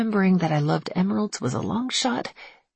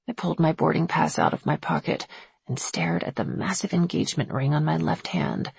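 ordinary delivery, nothing marked

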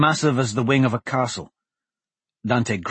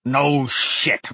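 rasping; 3 semitones higher than usual. Acted. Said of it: "Varlin rasped".

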